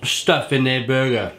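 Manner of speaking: talking between bites; mouth full, chewing. Real, not acted. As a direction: food in mouth. Seen 2: Burger in hand, cheeks and jaw show chewing mid-sentence.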